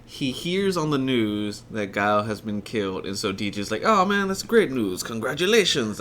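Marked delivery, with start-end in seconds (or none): 3.8-6.0 higher, hammed-up bad Jamaican accent; a lightly done bad Jamaican accent. Retelling DJ's awful movie Jamaican accent. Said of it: "That fucking horrible Jamaican accent".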